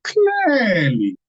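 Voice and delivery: CLEARLY drawn out, high, gliding down; stress on CLEARLY. Shown as a demonstration. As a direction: stretch "clearly" long from high pitch. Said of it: "I can speak my Efik language".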